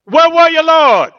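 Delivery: anguished where were you; desperate cry. Retold or imitated. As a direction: cry out feeling abandoned. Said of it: "in the hardest time of my life".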